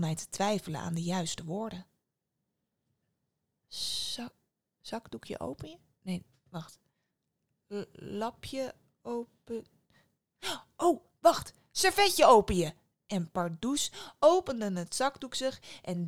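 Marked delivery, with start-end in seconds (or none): 3.7-5.8 softly fumbling for the word; trying the wrong words quietly; unsure mumble. Acted. Antoine hesitantly tries the wrong magic words. 6.0-9.7 soft, slow groping for words; half to himself, unsure; hesitant mumble. Acted. Antoine mutters, searching for the magic words. 10.8-12.7 higher, louder sudden recall; eureka. Acted. Antoine triumphantly finds the right magic words.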